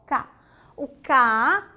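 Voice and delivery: ordinary delivery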